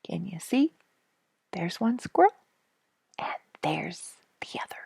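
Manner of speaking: ordinary delivery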